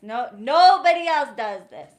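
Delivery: a sudden loud exasperated burst; exasperated outburst. Real, not acted. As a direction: throw it at them, fed up. Ranting at viewers.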